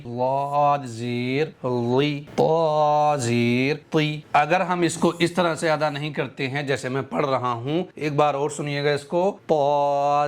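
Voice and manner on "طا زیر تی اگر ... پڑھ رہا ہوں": ordinary talk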